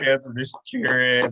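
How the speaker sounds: normal talking voice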